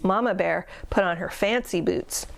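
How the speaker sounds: usual speaking voice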